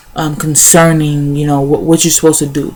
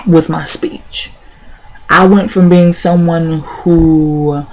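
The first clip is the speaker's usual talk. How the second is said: normal talking voice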